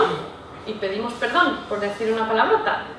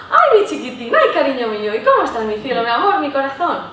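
put-on baby talk to a child; 2 semitones higher, 5 dB louder than usual. Shown as a demonstration. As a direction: coo over a little one with a swooping tune. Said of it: "toda esa curva entonativa que hacemos".

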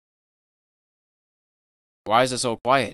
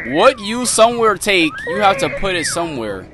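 agitated; 6 semitones higher, 6 dB louder than usual. Real, not acted. Puzzling over note, agitated.

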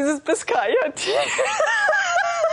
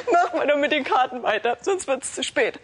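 laughing through words. Real, not acted. Giggling through the forecast.

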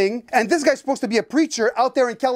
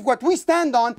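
normal talking voice

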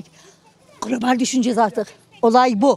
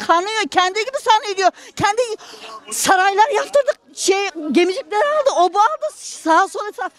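ordinary talk, nothing marked

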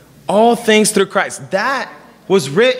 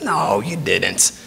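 slow, lower, softer reassuring wife; 11 semitones lower, 5 dB quieter, much slower than usual. Retold or imitated. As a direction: calmly brush off his worry. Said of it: "She goes".

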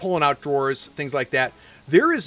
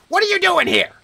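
high, loud angry demand; angry shout; 6 dB louder than usual. Acted. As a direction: bark it out, outraged. Corbett confronts, not happy.

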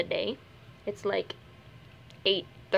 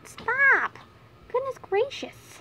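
much higher squeaky stop, then a slow goodness gracious; startled squeak; 13 semitones higher, 3 dB louder, much slower than usual. Real, not acted. Vlogger squeaking as the bunny grabs at her. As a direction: squeak in alarm.

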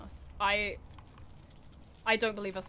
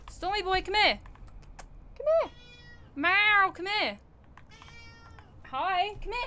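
higher sing-song pet calling; high, coaxing pet-owner call; calling the cat; 8 semitones higher, 4 dB louder than usual. Real, not acted. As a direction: coax the cat over.

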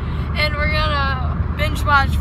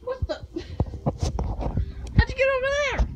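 high startled what the; surprised; 11 semitones higher, 3 dB quieter, much faster than usual. Real, not acted. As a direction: caught off guard, pitch jumps up. Surprised at friend appearing.

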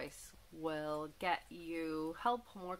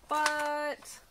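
high, louder teasing but; drawn-out suspense but; 7 semitones higher, 5 dB louder than usual. A narrator's reading. Reader teases the listeners before the letdown, high and drawn out. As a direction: hang on the word for suspense. Refrain build-up before punchline.

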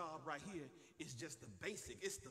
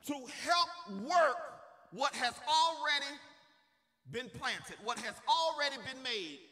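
raised, emphatic voice with hammered phrases; preaching at full force; 8 semitones higher, 11 dB louder than usual. Real, not acted. Preacher telling God's chosen to stop worrying, forceful preaching. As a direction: punch it out, pausing between bursts.

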